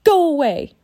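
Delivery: high, loud woman's yell; angry yell. A narrator's reading. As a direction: yell to drive them off. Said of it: "a woman yelled at the ravens".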